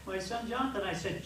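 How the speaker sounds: ordinary delivery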